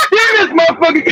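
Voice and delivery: cracking up mid-sentence; real laughter. Real, not acted. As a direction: talk through a laugh. Cracking up at own story.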